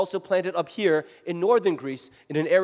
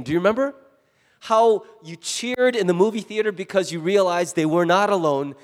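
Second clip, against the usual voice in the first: ordinary talk, nothing marked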